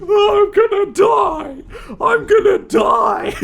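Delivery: very high, slow shouted wail; high slow mock wail of doom; mock Lancer panic. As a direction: wail like a cartoon kid curled in a corner. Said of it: "Lancer Deltarune is in a ball".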